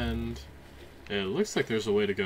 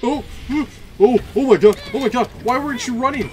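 high, loud frantic yells for help; real panic, shrieking the name; panicked screaming; 13 dB louder than usual. Real, not acted. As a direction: scream for the beast to save you. Chased by armor, calling companion.

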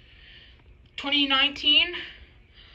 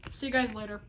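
ordinary delivery

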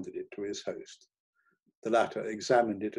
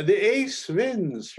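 high, loud, stretched-out announcement; triumphant gambler; 10 semitones higher, 7 dB louder, much slower than usual. A narrator's reading. Hermann turns up his last card, loud triumphant cry. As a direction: claim the win in a raised, slow call. Hermann sure he has won again.

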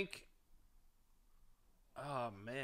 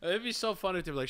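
ordinary delivery

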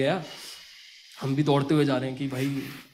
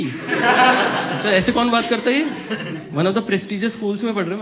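high, loud, drawn-out offended cop; indignant policeman; 4 semitones higher, 4 dB louder, slower than usual. Retold or imitated. Policeman scolds the rude student, high and outraged. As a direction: act personally insulted by a schoolkid.

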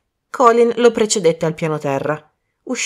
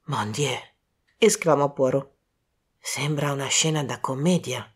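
much softer, lower Mon Dieu; hushed exclamation; 3 semitones lower, 5 dB quieter than usual. Acted. Poirot seeing the body, softly astonished. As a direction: say it under the breath.

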